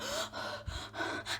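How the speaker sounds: breathless gasps, acted; mock panting, no words; imitated panicked gasping. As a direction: gasp as the shaken friend rushing in. Acts friend arriving out of breath.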